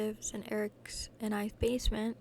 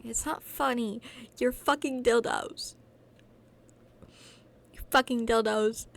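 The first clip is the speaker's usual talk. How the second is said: angry insult; 7 dB louder than usual. Acted.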